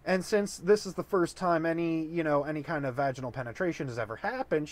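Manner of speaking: normal talking voice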